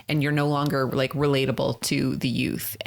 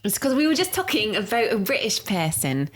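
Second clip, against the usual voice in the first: higher-pitched mock British accent; put-on British accent; 5 semitones higher than usual. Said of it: "Why do you got a little bit of a British accent".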